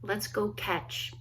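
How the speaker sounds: usual speaking voice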